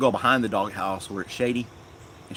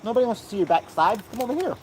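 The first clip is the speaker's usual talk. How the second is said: high cooing voice for the dog; pet talk; 11 semitones higher than usual. Real, not acted. Teasing the dog.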